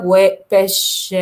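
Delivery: وبش drawn out very slowly with long ش; teacher repeats بش slowly; slow model word. Shown as a demonstration. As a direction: stretch بش slowly. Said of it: "بتكون بش".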